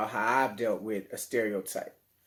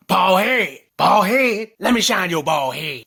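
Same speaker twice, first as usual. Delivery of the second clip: loud, higher mocking call across the street; teasing street dude; 5 semitones higher, 10 dB louder than usual. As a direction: heckle him from across the street. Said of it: "he be like".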